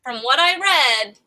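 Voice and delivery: usual speaking voice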